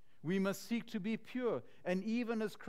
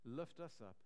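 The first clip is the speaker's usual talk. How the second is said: closing prayer; 3 semitones lower than usual. Acted. Pastor prays for his listeners, softening to a hush. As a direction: pray it back to the Lord, letting it die away.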